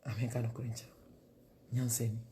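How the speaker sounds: usual speaking voice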